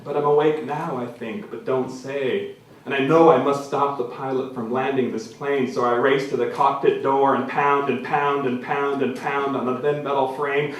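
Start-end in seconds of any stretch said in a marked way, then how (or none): none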